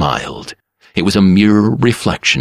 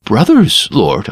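higher, startled question; feigned surprise; 5 semitones higher, 4 dB louder than usual. A narrator's reading. Barber plays dumb before the lord, higher surprise. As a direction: sound innocently surprised.

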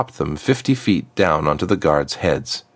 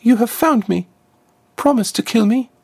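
put-on much higher, urgent girl's plea; pleading trapped girl. Trapped girl pleads with Azriakam to kill her, taut and high. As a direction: beg for the end in a taut, high voice.

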